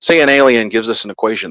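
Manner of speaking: ordinary talk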